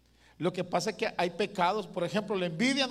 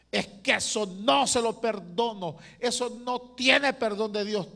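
louder and higher, mock indignant refusal; indignant quote; 4 semitones higher, 6 dB louder than usual. Pastor imitating a believer who refuses to forgive, indignant. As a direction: act out a stubborn believer who won't forgive. Voicing an unforgiving church member.

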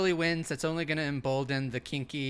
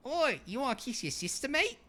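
much higher, indignant mock Aussie; Australian accent; 11 semitones higher than usual. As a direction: squeal it like an outraged Aussie sister. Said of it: "These are really good impressions".

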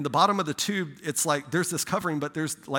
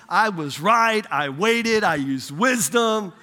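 gloating; 5 semitones higher, 5 dB louder, slower than usual. Retold or imitated. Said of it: "dismantling the haters".

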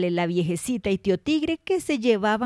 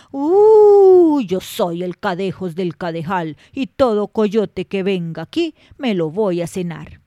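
put-on loom over the old woman as a terrifying beast; horrible monster voice; 7 dB louder than usual. A narrator's reading. The Cadejos threatens the old woman in her bed, booming monster voice. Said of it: "sale la voz horrorosa".

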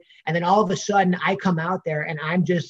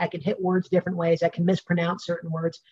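usual speaking voice

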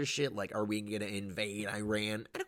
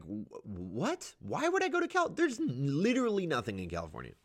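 incredulous; 6 semitones higher than usual. Retold or imitated. Incredulous retort.

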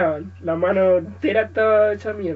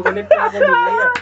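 high-pitched laughing through the words; 10 dB louder than usual. Real, not acted. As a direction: crack up while saying it. Joking about useless left hand.

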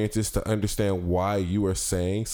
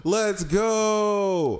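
excited; 10 semitones higher than usual. Real, not acted. Celebrating news.

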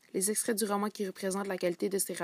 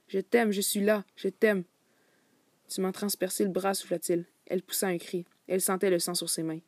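lively reading of a novel passage with voiced dialogue; story narration; 5 dB louder than usual. A narrator's reading. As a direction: read the excerpt to the class like a storyteller. Student reads novel excerpt aloud. (lecture expressive d'un roman à voix haute)

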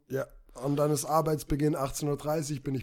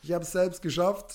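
usual speaking voice

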